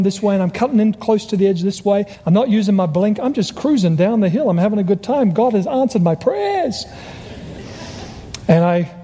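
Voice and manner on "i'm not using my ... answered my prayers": elated. Retold or imitated. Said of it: "I'm elated, and I'm ecstatic".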